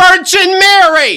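Andrew Dice Clay. Retold or imitated. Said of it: "Andrew Dice Clay".

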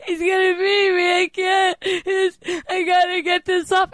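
hammed-up tearful desperation; fake crying. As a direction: beg through put-on sobs. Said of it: "I'm starting to cry".

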